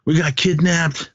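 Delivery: high-pitched, urgent mock plea; retold plea for help. Peter telling the hunters they were kidnapped, high retold plea. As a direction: act out a captive flagging down help. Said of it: "and was telling him".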